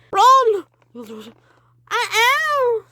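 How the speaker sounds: very high loud yell; frantic shout. Acted. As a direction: yell at them to flee. Fleeing the witch.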